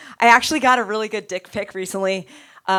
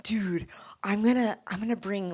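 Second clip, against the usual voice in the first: whispering; 4 dB quieter than usual. Retold or imitated. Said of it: "I was like".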